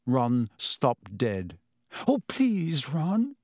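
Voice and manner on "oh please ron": pleading. A narrator's reading. Hermione begs Ron to move.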